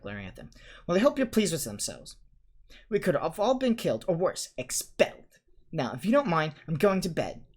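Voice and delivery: high, indignant and prim; cross girl storming off to bed; huffy scolding. A narrator's reading. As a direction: scold them, then flounce off. Hermione scolding then leaving.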